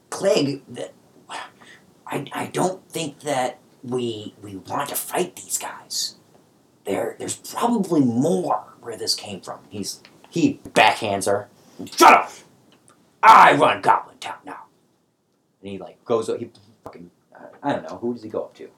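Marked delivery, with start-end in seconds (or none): 0.1-9.6 soft, slow, breathy plea to the boss; timid goblin whisper. Acted. Female goblin pleading with the boss, hushed whisper. 12.0-14.6 very high, loud furious yell; goblin shout. Acted. Sumo goblin silencing the female goblin, furious high shout. Krieg backhands her, yells.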